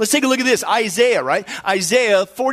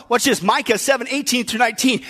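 normal talking voice

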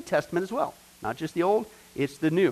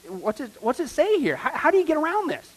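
mock incredulous; 9 semitones higher than usual. Mocking the Jesus Only group.

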